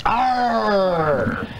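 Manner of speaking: pirate growl. Pretend. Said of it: "International Pirate Day".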